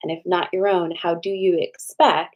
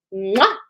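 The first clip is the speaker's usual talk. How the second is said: loud smacking air kiss; kiss sound; 2 semitones higher, 4 dB louder than usual. Real, not acted. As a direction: blow a big kiss into the mic. Blowing kiss to viewers.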